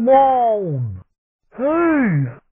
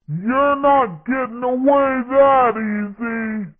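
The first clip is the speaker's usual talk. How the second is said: animated battle-radio sidekick lines; game character voice. Acted. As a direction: act out short cartoon combat callouts.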